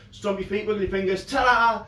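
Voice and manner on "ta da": higher, louder ta-da with a flourish; showy ta-da. Shown as a demonstration. Magician leading viewers through the trick, loud cheerful ta-da. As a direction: belt it out for the crowd. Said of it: "put them all together like this".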